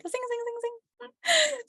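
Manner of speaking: high, bright chant of zing; zing the energy up high; excited zing. Retold or imitated. Trainer mimics her buzzing energy, excited and high. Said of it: "I'm like".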